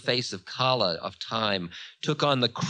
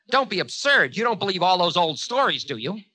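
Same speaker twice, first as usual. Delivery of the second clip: high, loud, quick scoff; 9 semitones higher, 7 dB louder than usual. A narrator's reading. Time mocking Ravana's belief in old stories, scoffing. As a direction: dismiss it fast in a high voice.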